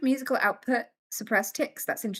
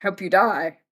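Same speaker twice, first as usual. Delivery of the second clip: passing taunt; 3 semitones lower, 8 dB louder, slower than usual. Retold or imitated.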